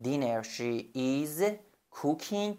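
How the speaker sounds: very slow, soft She is cooking dinner; slow quiet dictation; slow model sentence. Shown as a demonstration. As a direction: dictate gently word by word.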